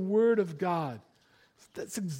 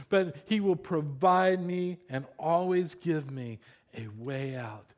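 ordinary delivery

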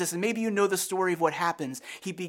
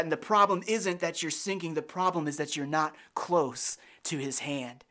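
ordinary delivery

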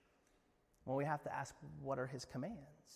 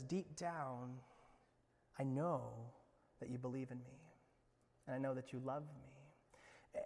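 softer, intimate reassurance; tender Jesus; 2 semitones lower, 6 dB quieter than usual. Acted. Jesus tells Peter he knows his heart, softly. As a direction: say it close and soft.

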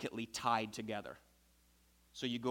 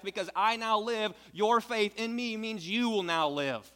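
high, loud, building preacher's proclamation; preaching at full force; 8 semitones higher, 6 dB louder than usual. Acted. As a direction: proclaim it like a triumphant promise. Preacher voicing Jesus' promise.

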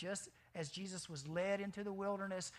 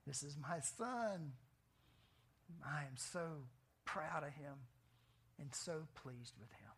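slow, softer, loving words of a proud father; tender Father's voice; 3 semitones lower, 3 dB quieter than usual. Acted.

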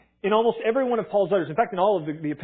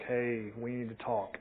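much softer, low and heavy, dreading it; quiet ominous breakup line; 6 semitones lower, 10 dB quieter, slower than usual. Retold or imitated. Teenager on a breakup call, quiet and low. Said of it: "breakup phone calls, it was like".

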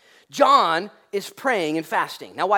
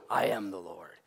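quiet declaration; 10 semitones lower, 7 dB quieter than usual. Retold or imitated. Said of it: "Jesus shows up, boom".

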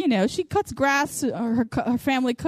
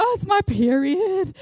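drawn-out, higher-pitched excuse; mock whining; 5 semitones higher than usual. Woman excusing her bad mood with PMS, mock whining. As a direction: put on a slow, whiny complaint.

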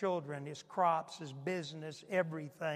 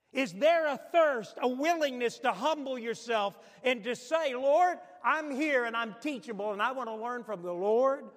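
higher, louder preacher's call; fervent preaching; 5 semitones higher, 6 dB louder than usual. Acted. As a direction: press the congregation, rising in fervour. Preaching builds.

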